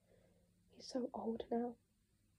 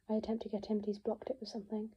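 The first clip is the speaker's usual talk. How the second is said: usual speaking voice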